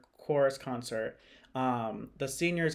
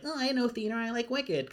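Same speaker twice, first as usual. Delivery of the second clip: higher-pitched mock fangirl quote; lightly mocking quote in a higher voice; mock theater fan; 7 semitones higher than usual. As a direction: imitate a basic musical fan, pitched up.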